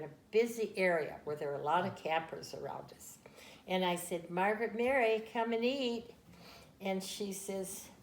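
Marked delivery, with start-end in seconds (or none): 4.3-6.1 put-on raised voice calling a child to dinner. Mother calls daughter in to eat, raised call. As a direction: call her in from the grill, loud.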